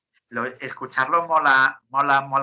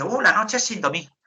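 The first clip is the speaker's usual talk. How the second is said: lightly put-on higher voice with an "uh"; higher, lightly mimicked reply; imitated interviewee; 7 semitones higher, 3 dB louder than usual. Danish woman answering about her night, lightly mimicked.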